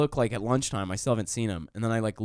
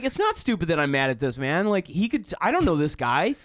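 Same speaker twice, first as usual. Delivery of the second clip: heated retort; 8 semitones higher than usual. Retold or imitated. Arguing back at roommate.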